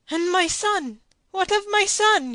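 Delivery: a father's panic; frantic cry. A narrator's reading. Don Diego cries out for his son, frantic. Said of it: "he cried out".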